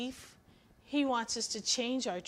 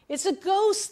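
a sudden, higher, louder frightened cry; startled yelp of fear; terrified cry; 10 semitones higher, 11 dB louder than usual. Acted. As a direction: blurt it out as if you'd seen a ghost. Disciples terrified, seeing a ghost.

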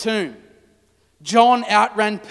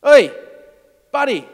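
higher, pushy challenge; confrontational; 4 semitones higher, 4 dB louder than usual. Acted. Mary confronting the supposed gardener, combative. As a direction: square up to him. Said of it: "she sized him up".

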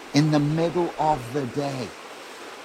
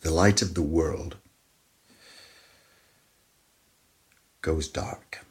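grave, hushed sermon delivery; hushed solemn preaching; 6 semitones lower, 5 dB quieter than usual. Acted. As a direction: let each phrase sink into silence. Somber climax of crucifixion.

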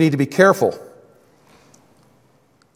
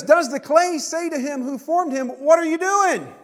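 voicing the clay; 10 semitones higher than usual. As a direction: lift the voice high for the clay talking back. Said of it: "But he says".

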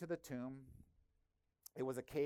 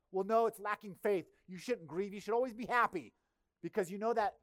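louder, higher, preachy know-it-all tone; mock preachy believer; 7 semitones higher, 7 dB louder than usual. Mocking church folks who tell the grieving to be happy. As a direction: parody the glib comforter quoting scripture. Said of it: "That verse that's thrown around".

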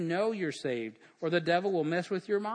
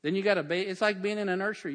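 ordinary delivery

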